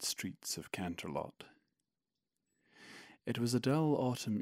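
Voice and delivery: measured storybook reading voice; story narration. Acted. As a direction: read it like a bedtime tale. Reads his own fic aloud.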